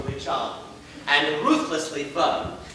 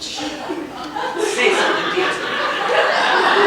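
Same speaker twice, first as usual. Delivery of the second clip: high, slow laughing coax; 12 semitones higher, 8 dB louder, much slower than usual. Acted. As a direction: giggle it through. Laughing coaxing line.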